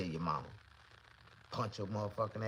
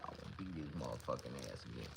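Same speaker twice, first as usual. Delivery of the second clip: normal talking voice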